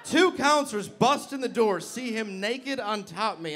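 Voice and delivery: ordinary talk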